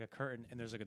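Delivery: ordinary talk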